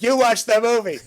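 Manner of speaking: loud, high accusing shout; mock parental yelling; furious father. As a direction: imitate an enraged dad, hammed up. Reprising enraged father's accusation.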